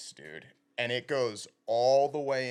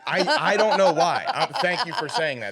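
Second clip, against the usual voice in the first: high, loud, laughing mock gratitude; laughing thanks; 8 semitones higher, 10 dB louder than usual. Real, not acted. Answering the cohost's teasing, laughing thanks. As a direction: thank him through laughter.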